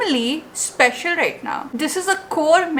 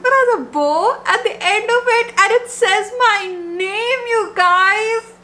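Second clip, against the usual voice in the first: high, tearful rush of joy; higher, near-tearful excitement; moved and excited; 7 semitones higher than usual. Real, not acted. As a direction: voice lifting with emotion at her name. Sees her name on bookmark.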